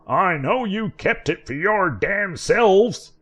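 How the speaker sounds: put-on old man wailing his demands at the boys.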